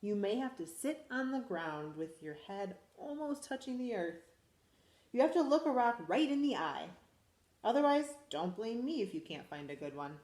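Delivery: lively storybook read-aloud; animated picture-book reading; reading to children. Acted. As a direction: read it to a class of little kids. Teacher reads picture book aloud.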